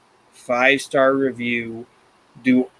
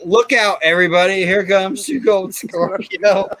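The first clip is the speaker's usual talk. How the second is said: higher-pitched mock alarm; imitated warning; 6 semitones higher than usual. Imitating the singer warning people about Scorpio, mock-frightened warning. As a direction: warn everyone in comic panic. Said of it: "was she warning people".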